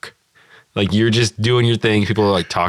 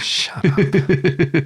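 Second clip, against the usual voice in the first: dismissive; 9 semitones higher than usual. Retold or imitated.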